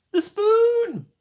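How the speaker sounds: very high, drawn-out cartoonish cry; put-on squeal. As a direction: squeal it far above your normal pitch. Sudden put-on character voice.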